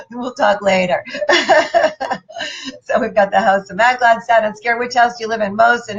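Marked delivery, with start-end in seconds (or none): 1.1-2.8 a short amused laugh; light laugh. Real, not acted. Teasing Louise about stress, a light laugh. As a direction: chuckle it away.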